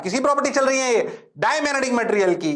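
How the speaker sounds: usual speaking voice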